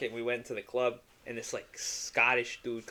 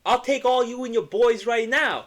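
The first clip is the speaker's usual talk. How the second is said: much higher, louder mock tough guy; mock threat; 12 semitones higher, 7 dB louder than usual. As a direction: parody a drunk big man threatening. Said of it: "he's like".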